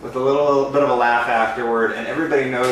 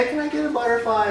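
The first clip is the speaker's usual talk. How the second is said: put-on high, quick little kid voice; kid asking; 11 semitones higher, faster than usual. Balloon artist imitating the kid, high and quick. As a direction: squeak it out like an eager kid. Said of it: "I said".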